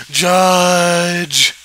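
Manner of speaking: a player at an event shouting for the judge, mock call.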